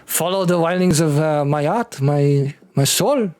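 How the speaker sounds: put-on halting French-accented apology, rising at the end; apologetic French-accented husband. Husband apologizing to his slapping wife, French accent. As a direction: plead romantically in a French accent.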